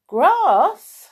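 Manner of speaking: high, loud "Grass?"; incredulous, high-pitched, insistent "Grass?"; emphatic amazement. Acted. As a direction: push "Grass?" out loudly, as if it were obvious. Farmer insists, amazed at Moo.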